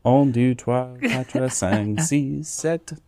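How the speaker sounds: counting in a schoolbook French accent. Shown as a demonstration. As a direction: show off classroom French numbers. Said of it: "six years of French".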